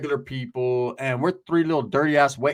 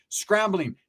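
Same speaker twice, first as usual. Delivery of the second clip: ordinary talk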